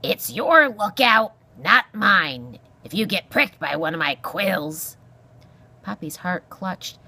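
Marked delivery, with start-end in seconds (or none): 0.0-5.0 loud, irascible porcupine voice; grumpy old porcupine. Acted. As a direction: scold as a crotchety old animal. Porcupine warns the mouse.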